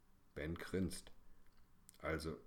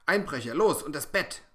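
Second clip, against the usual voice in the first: much louder, higher urgent command; commanding shout; 9 semitones higher, 13 dB louder than usual. A narrator's reading. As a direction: order her up at once. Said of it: "kommandiert Ben".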